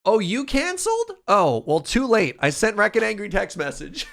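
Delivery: loud excited reaction. Real, not acted. Reacts loudly to cancelled plans.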